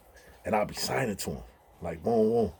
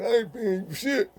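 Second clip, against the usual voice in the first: high, slurred deaf-speech impression; imitating a deaf friend's speech; 13 semitones higher than usual. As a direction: voice him high and thick like he can't hear himself. Deaf friend voiced again.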